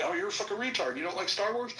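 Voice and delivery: lightly voiced sneering kid; mocking schoolyard taunt. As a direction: toss it off like a teasing teenager.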